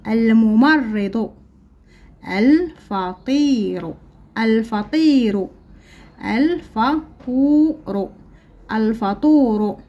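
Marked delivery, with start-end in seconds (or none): 2.3-3.9 الفطير stretched slowly; الفطير drawn out slowly. Shown as a demonstration. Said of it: "لدينا الكلمات نقرأها". 6.4-8.1 الفطور stretched slowly and lifted; الفطور drawn out slowly. Shown as a demonstration. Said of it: "لدينا الكلمات نقرأها".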